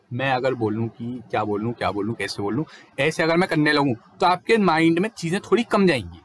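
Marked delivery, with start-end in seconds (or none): 0.1-2.6 hesitant broken speech. Shown as a demonstration. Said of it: "अगर मैं धीरे धीरे या टूट टूट के उसे बोलूँगा".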